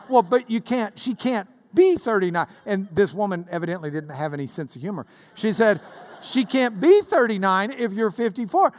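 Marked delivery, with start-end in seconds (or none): 0.1-2.5 raised, louder voice of a nurse objecting; exasperated nurse. Retold or imitated. Nurse objects to the joke answer. 6.2-8.7 higher, louder retold protest; exasperated nurse. Humorless nurse insists on the arithmetic.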